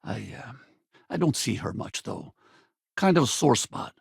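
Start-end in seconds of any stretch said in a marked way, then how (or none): 0.0-2.4 very soft, halting admission; hesitant confession. Acted. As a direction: trail off quietly, reluctant to say it. Confiding about estranged daughter.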